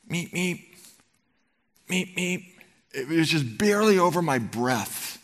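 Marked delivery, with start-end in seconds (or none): 0.1-2.4 higher, light imitation of a little car horn; car horn beeps. Husband imitating the horn heard from the hilltop, mock beeping. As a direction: beep it out like a distant horn. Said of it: "I hear in the distance a horn".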